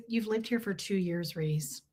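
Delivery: low, quiet judgy deadpan; mom's low, quiet deadpan jab; deadpan Kristen Wiig. Retold or imitated. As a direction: drop it flat and low like a put-down. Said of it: "She did the Kristen Wiig thing".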